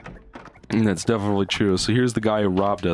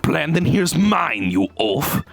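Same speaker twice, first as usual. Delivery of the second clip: mock German accent; 2 semitones higher than usual. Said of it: "I was trying to do German".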